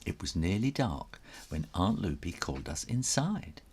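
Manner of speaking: usual speaking voice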